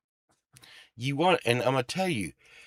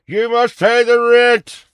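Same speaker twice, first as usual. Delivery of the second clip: loud, high, slow melodrama villain; mustache twirling villain; 11 semitones higher, 12 dB louder than usual. Acted. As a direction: boom it like a silent-film villain. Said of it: "I just did a play for y'all".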